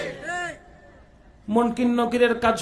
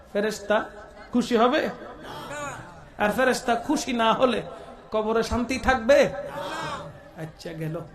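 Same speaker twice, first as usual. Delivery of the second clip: ordinary delivery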